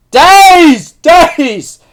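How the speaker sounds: high, loud, drawn-out cry; dramatic exclamation. Real, not acted. Reviewer vowing to shut down if Tohru is hurt, dramatic cry. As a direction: wail each word for exaggeration.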